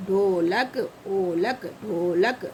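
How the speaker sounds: ढोलक pitched low, dho held before lak; long o. Shown as a demonstration. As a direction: hold the o in ढोलक. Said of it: "इसको हम लोग कैसे pronounce करते हैं?".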